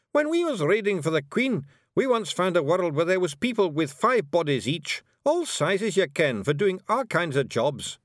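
high, loud wee Scots warrior voice; Scottish Feegle Rob. A narrator's reading. Rob Anybody brags to Miss Level, high Scots Feegle. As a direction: play a cocky little Feegle, high and brash.

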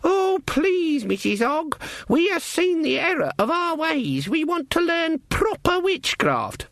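very high falsetto pleading; mocking falsetto. Granny mimicking girls begging to learn witchcraft, mocking falsetto. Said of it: "Her voice went falsetto".

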